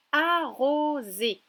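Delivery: "arroser" very slow and loud, every vowel held; syllable by syllable. Shown as a demonstration. Teacher reading the second word to a child, syllable by syllable. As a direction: draw out "arroser" in three long syllables. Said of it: "J'aimerais que tu retrouves la syllabe".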